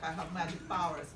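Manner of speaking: ordinary talk, nothing marked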